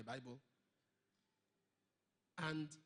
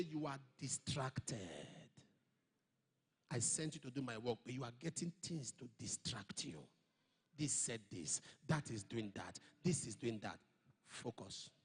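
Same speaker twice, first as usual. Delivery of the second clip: the Lord rebuking; 7 semitones lower than usual. Retold or imitated.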